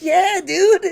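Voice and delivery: high, loud retold comeback; emphatic insistence. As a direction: push back hard on the doubter. Retold argument reaching its peak.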